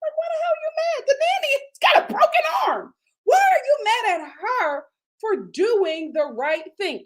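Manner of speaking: high incredulous outburst; indignant. Real, not acted. Vlogger baffled by husband's anger, high indignant outburst. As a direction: fire it at him in disbelief.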